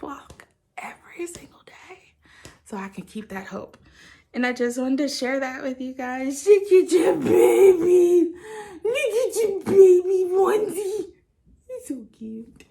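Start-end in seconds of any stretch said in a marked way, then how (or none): 0.0-2.1 breathy whisper of a private ritual. Real, not acted. Sharing her private ritual. 6.5-12.6 high, slow, teary squeal over something cute; tearful squeal. Real, not acted. Squealing over the baby onesie.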